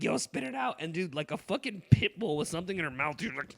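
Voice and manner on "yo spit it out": high, stern mock order; imitating husband. Retelling the husband guarding mouthwash, mock stern order. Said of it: "stand in front of her and be like".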